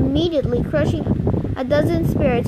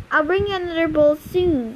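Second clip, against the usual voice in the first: plain reading voice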